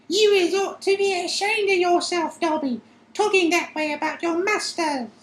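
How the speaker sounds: very high elf voice, scolding; high squeaky elf scolding. Acted. Winky scolding Dobby in a high elf voice. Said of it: "squealed Winky furiously".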